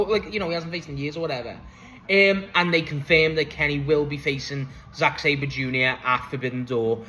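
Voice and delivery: ordinary delivery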